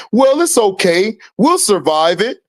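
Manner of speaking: ordinary talk, nothing marked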